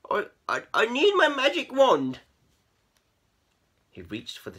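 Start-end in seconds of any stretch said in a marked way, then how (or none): 0.1-2.2 stutter, then burst out high and loud; halting start rising to a cry; stammering. A narrator's reading. Keith stalling for time, stammered outburst. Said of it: "stammered Keith".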